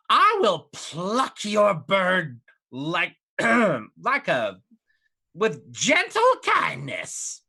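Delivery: sweetly menacing reassurance; mock-gentle hag. [name] promises gentleness.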